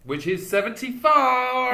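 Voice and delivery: hammed-up announcement. Exaggerated episode announcement.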